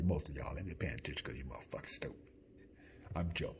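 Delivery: a sly unvoiced jab at the audience; mock whisper. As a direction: whisper the insult under your breath. Teasing aside to viewers.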